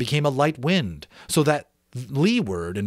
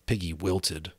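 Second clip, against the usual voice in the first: plain reading voice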